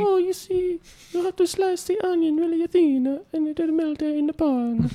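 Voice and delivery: very high put-on cooking voice; a squeaky exaggerated TV chef; high mock chef voice.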